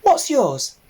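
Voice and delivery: high eager little pig; piglet voice. Acted. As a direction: ask it like a keen young piglet.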